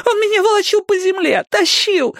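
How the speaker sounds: high, aggrieved female voice; whining girl. A narrator's reading. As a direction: plead for sympathy. Girl complains to Robin.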